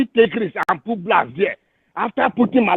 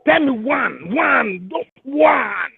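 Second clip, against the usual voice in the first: angry shout; much slower than usual. Real, not acted. Ranting speaker shouts his challenge at the prophets. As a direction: cry out the demand with a raw edge.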